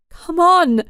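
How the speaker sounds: high, loud urgent shout. Acted. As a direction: yank him out with a yell. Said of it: "she shouted".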